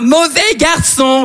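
high, drawn-out film title; hammed-up title announcement. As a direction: proclaim the title, stretching it out. (titre clamé, voix aiguë et traînante)

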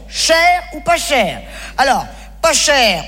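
slow with pas drawn out; dramatic weighing. Acted. Comic weighing coffin prices, slow and deliberate. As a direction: lean hard on pas, deliberately.

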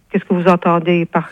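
normal talking voice

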